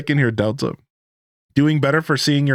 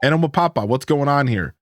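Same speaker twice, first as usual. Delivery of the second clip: ordinary talk, nothing marked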